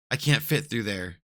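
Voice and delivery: ordinary delivery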